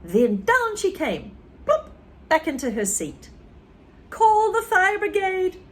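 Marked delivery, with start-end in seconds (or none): 4.1-5.6 higher, louder panicked shout; panicked granny shout. Acted. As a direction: yell for help in panic. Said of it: "she shouted suddenly".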